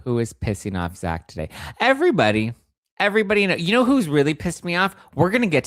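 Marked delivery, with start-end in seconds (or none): 1.8-5.7 high, louder fed-up cry; exasperated outburst. Real, not acted. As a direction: blow up, fed up with everyone. Exasperated rant opener.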